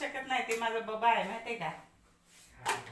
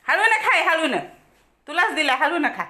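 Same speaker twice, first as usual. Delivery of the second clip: urgent admonishing; 6 semitones higher, 10 dB louder than usual. Real, not acted. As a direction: urge a greedy dog, loud and high. Urging the eating dog to slow down.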